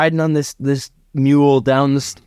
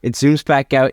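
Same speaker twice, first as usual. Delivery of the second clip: usual speaking voice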